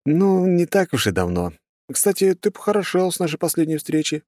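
high-pitched, easy character voice; complimenting hero. A narrator's reading. Hero praises the god's changed looks, complimentary. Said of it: "похвалил я изменения".

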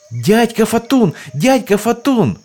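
high excited shouting. Acted. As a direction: yell it out like excited kids. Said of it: "орали они наперебой".

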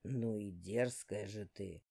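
low, slow sly villain; a deep, unhurried demon lord; dark lord voice. Acted. Dark speaking.

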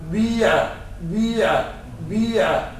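بيع with lips rounded on the ee; vowel between oo and ee in بيع; ishmam, rounded kasra. Shown as a demonstration. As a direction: tint the i of بيع with u. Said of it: "من ضم الشفتين إشارة إلى الضمة".

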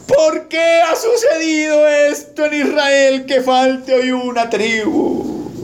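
high, very drawn-out wail, slowly sinking; overdone sobbing plea with stretched vowels; mock weeping lament. Pastor voices Israel lamenting a missing tribe, mock weeping. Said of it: "Pero aquí están llorando".

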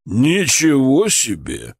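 slow, drawn-out amazement; stretched-out, impressed surprise; surprised. A narrator's reading. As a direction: draw it out in astonishment. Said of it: "удивился Гордей".

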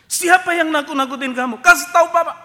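loud, high demand to know who did it; heroic father shout. Retold or imitated. Father bellows at the son to name the bully. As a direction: boom out like a protective dad.

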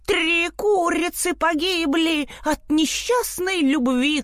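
high, slow rooster outcry; crowing rooster. A narrator's reading. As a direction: proclaim it like a rooster. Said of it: "а он уж кричал".

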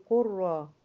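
قرة with a held, rolled R; merged ra. Shown as a demonstration. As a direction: draw out the doubled R in قرة. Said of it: "أما تضغم دي في دي هقول".

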